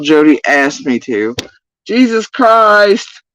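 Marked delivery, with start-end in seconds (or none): 1.9-3.2 drawn-out, high, loud exasperated Jesus Christ; real exasperation, slow and loud. Real, not acted. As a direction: stretch it out in disbelief. Exasperated outburst at posts.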